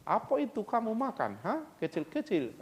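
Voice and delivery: a puzzled foreigner asking about rice. Retold or imitated. Preacher voicing a foreigner puzzled by rice, mock foreign voice.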